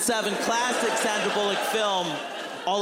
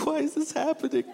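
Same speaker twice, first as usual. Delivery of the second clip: high-pitched mock sobbing; crying; 10 semitones higher than usual. Actor playing a widower cries into his hands, mock crying. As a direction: imitate an actor wailing through tears. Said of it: "he starts crying into his hands".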